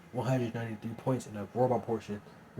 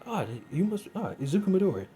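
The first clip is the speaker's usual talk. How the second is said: higher visitor voice; teacher's voice; 8 semitones higher, 5 dB louder than usual. Acted. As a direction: greet him, pitched up.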